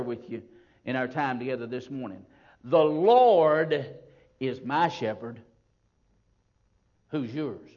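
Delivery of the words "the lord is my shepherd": "Lord" loud and stretched, then a drop in pitch; stress on THE LORD. Shown as a demonstration. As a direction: proclaim "Lord" with full weight. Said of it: "That's the part that I want to talk to you about".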